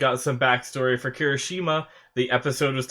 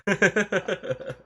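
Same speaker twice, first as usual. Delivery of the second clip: soft, high titter; soft giggle; 5 semitones higher, 5 dB quieter than usual. Real, not acted. Host teasing viewers who stayed, soft giggle. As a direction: giggle quietly at your own joke.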